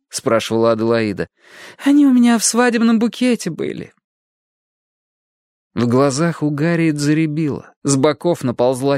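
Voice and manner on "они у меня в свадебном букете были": high nostalgic flutter; gushing old lady. A narrator's reading. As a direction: recall the wedding fondly. Adelaide voiced by narrator.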